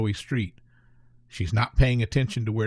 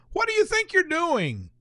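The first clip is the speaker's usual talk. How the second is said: lightly voiced stern old man, much higher. A narrator's reading. Old man scolding the boys, lightly voiced stern demand. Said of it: "his voice firm and authoritative".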